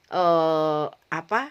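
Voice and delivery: ordinary talk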